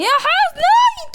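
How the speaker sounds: high, drawn-out cry of woe; wailed lament. Real, not acted. Player lamenting she can't play it, wailed lament. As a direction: stretch it into a high wail.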